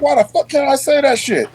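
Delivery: high-pitched, exasperated challenge; retold indignant comeback. Sergeant replaying his pushback when told off, indignant. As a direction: argue back in disbelief. Retells arguing back at work.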